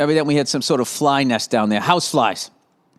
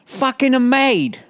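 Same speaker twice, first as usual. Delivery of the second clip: high-pitched, hammed-up English accent; 6 semitones higher than usual. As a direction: put on a silly high English voice. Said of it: "why she has an English accent".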